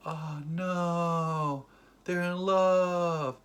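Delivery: high, drawn-out mock wail; mock dismay. Host reacting to an imagined couple, mock-dismayed drawl. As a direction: stretch it out in pretend heartbreak.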